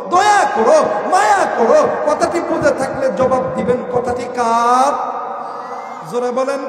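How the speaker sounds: loud ringing exhortation; fiery preaching. Real, not acted. Preacher gives God's order to honour parents, loud fervent preaching. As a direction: proclaim the command to the whole crowd.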